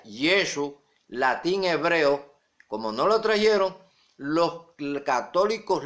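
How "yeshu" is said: "Yeshu" as YEH-shu, with sh; correct Hebrew pronunciation. Shown as a demonstration. Preacher citing the original Bible, "Yeshu" as YEH-shu. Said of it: "donde trae la pronunciación correcta".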